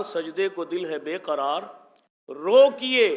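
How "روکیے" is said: روکیے called out high and loud; high loud روکیے, falling at the end; emphatic stop. Shown as a demonstration. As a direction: hit روکیے hard, like a command. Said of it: "ایک مرتبہ نہیں".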